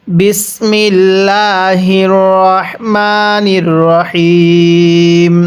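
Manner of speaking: drawn-out Quranic chant of the bismillah; slow recitation. Acted. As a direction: intone it slowly, stretching each syllable. (ধীর সুরে তিলাওয়াত)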